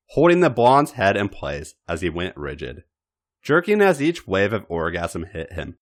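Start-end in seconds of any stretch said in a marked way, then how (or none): none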